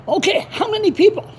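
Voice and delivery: high-pitched retold reply; exasperated retort. Acted argument with the caller.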